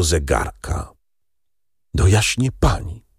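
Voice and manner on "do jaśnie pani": hushed, stunned repetition; whispering. A narrator's reading. Father repeats the servant's words in shock, whispering. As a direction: murmur it as if dazed, half whispered. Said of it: "szeptał".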